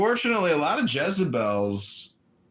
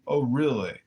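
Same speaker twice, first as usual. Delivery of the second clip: ordinary talk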